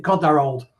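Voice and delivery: ordinary talk, nothing marked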